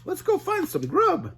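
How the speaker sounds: high Shaggy, not actually whispered; hungry scheming Shaggy; mock whisper in high Shaggy voice. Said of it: "Shaggy whispered".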